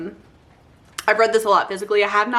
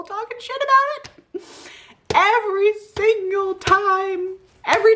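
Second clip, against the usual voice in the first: anguished squeal; 13 semitones higher than usual. Real, not acted. Hurts my soul hearing hate.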